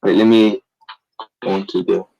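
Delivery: ordinary talk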